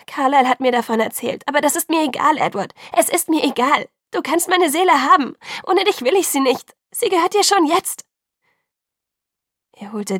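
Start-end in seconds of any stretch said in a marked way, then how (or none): 0.1-8.0 loud, high, desperate plea; words burst out, angry yet begging; angry pleading outburst. A narrator's reading. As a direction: cry out imploringly at raised pitch. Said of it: "hörte es sich immer noch flehend an".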